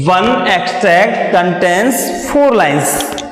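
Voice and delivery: very slow, word by word English; slow dictation. Shown as a demonstration. Teacher dictating the line slowly to the class. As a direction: dictate it slowly so students can copy. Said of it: "teacher dictates slowly".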